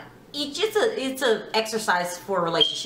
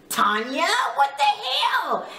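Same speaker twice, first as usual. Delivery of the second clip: exasperated disbelief; 6 semitones higher, slower than usual. Real, not acted. Reacting to the shove.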